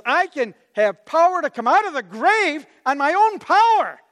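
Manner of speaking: high, amazed proclamation; astonished retelling. Acted. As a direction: proclaim it as an unbelievable claim. Astonished retelling of Jesus's claim.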